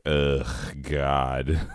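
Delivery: groaning ugh, fed up; mock disgust at the request; exasperated groan. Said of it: "And I was like".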